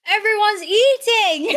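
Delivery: high, loud, laughing complaint; exasperated laughing outcry. Real, not acted. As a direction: cry out while laughing. Jealous that others are eating.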